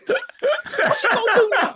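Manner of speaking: slow taunt through laughter; laughing taunt. Real, not acted. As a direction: tease while laughing.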